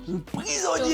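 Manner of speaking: very high, mock squeal; voix aiguë moqueuse. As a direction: tease in a silly high-pitched voice. Répète la moquerie en riant. (voix suraiguë pour taquiner)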